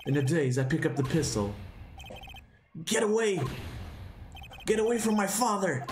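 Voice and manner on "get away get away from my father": high, urgent yell; desperate shout. Acted. Boy holding a pistol, protecting his father, desperate shout. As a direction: scream at the attacker to back off.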